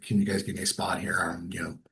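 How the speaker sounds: hushed, pitiful little plea; whispered mock-frail plea for help; self-deprecating whisper. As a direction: shrink down and beg for a spot in a whisper. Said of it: "self-deprecating".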